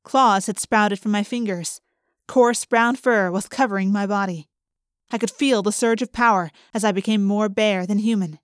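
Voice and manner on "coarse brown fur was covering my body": ordinary delivery, nothing marked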